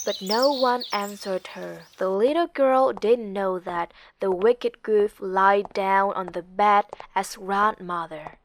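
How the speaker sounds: plain reading voice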